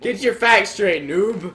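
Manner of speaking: playful scolding. Real, not acted. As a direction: put the friend down in jest.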